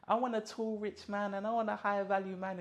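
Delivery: high, mocking imitation of girls listing demands; hint of a mocking high voice; mock girly voice. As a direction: lightly parody a picky dater. Mocking girls' stated wishes.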